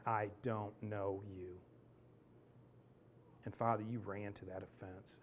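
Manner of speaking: soft, earnest prayer voice; preacher praying softly for the congregation; closing prayer. Acted. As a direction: pray gently over the room.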